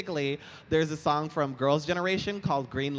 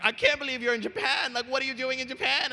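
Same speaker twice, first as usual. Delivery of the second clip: high, louder, starstruck squeal; excited fan; 11 semitones higher, 3 dB louder than usual. Retold or imitated. Imitating the fan gushing over seeing him. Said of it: "And he was like".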